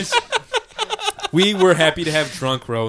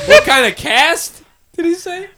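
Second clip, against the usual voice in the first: laughing; 12 semitones higher, 4 dB louder than usual. Real, not acted. Laughing repeat of voicemail.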